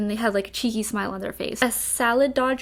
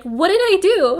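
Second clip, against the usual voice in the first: high, loud baffled cry; a friend confused by constant British insults, baffled and loud; 8 semitones higher, 8 dB louder than usual. Retold or imitated.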